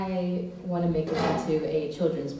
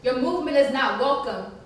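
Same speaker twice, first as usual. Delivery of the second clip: scolding; 5 semitones higher, 7 dB louder than usual. Retold or imitated. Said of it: "so I told her".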